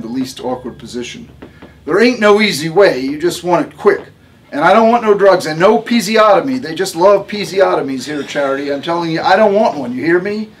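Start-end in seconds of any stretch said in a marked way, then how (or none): 1.8-10.5 higher, louder, bossy mother in labor; a tough, no-nonsense patient giving orders; Harmony's voice. A narrator's reading.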